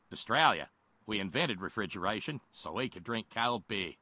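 playful Australian impression; Australian accent. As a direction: put on an Aussie accent. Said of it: "to do my Australian accent".

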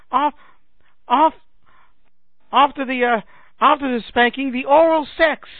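high, loud, excited film-character impression; Zoot. Comic imitating a Monty Python character, high and excited. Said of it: "I can just see Zoot from Monty Python".

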